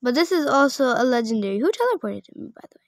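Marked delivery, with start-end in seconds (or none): 0.0-1.6 fast, run-on objection; quick protest. Real, not acted. Player protesting that the pet is legendary, quick protest. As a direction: argue it in one quick breath. Repeats the typed line in protest.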